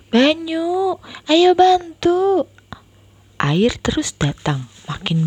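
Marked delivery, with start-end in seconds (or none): 0.1-2.5 high, slow urgent call. Acted. Big sister calling brother to help save books, urgent. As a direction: hurry the little brother along.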